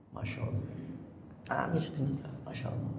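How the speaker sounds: whispered Masya Allah, voice breaking; choked whisper. Real, not acted. Preacher moved to tears by memory of the Ka'bah, whispering. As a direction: barely breathe it out, overcome.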